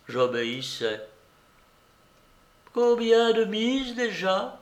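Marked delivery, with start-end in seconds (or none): 2.7-4.6 high, slow, impatient question; gritted-teeth impatience. A narrator's reading. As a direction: ask through gritted teeth, drawn out and high. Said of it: "en grinçant des dents d'impatience". (impatience contenue, entre les dents)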